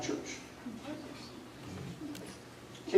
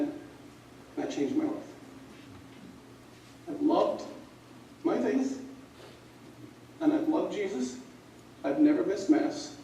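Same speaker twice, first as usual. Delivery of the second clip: emotional; 10 dB quieter than usual. Real, not acted. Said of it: "I get emotional with this stuff".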